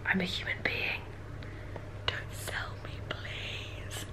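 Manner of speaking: tiny helpless whisper; very soft, voiceless inner protest; whispered plea. Retold or imitated. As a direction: plead almost silently, spacing it out. Horrified inner plea at being priced.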